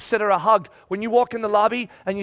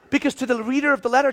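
usual speaking voice

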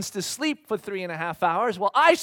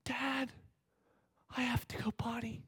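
put-on child's whisper, much softer; 3 semitones higher, 14 dB quieter than usual. Daughter whispering to her dad on the plane, soft whisper. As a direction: whisper it like a small girl. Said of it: "she goes".

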